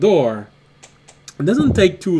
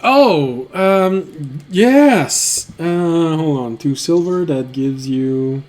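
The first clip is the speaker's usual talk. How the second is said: lightly flustered innkeeper, raised voice, halting; a bit thrown, stalling between louder little bursts; caught off guard; 4 dB louder, much slower than usual. Acted. Innkeeper suddenly tossed two silver by a guest, mildly flustered.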